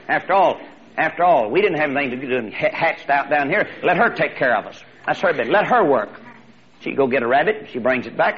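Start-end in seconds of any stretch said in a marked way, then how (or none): none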